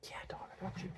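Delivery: whispered quick reply. Real, not acted. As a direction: whisper back off-mic. Quiet reply during recording.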